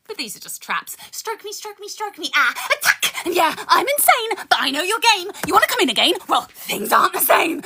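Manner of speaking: rhythmic poetry recital with dark punchlines; spoken word poem. Acted. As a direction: perform it like a slam poem. Continues the poem.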